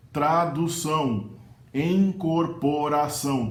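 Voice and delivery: tradução and incorporação with each vowel fully sounded, slowly; every vowel clear. Shown as a demonstration. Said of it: "Todas as vogais são audíveis".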